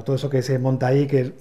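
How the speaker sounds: normal talking voice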